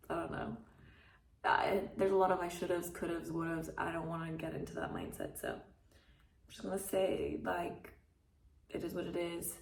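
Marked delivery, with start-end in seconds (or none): none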